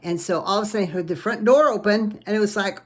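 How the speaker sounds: plain reading voice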